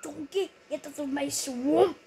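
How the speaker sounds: a hint of an ogre telling Donkey off; Shrek impression. Shown as a demonstration. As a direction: growl it out like Shrek. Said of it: "How was that impression, eh?".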